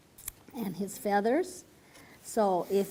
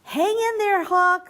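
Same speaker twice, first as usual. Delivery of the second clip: higher, louder plea to the hawk; urging call; 8 semitones higher, 6 dB louder than usual. A narrator's reading. Father frog urging the hawk on, high and loud. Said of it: "said father".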